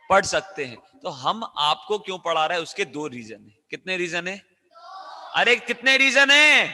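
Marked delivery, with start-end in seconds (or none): 5.4-6.7 high, loud, stretched-out question; demanding shout. Real, not acted. Teacher demanding an answer from the class, demanding shout.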